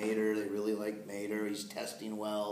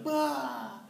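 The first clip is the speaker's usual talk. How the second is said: excited yelp; 14 semitones higher than usual. Retold or imitated. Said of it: "he's literally like".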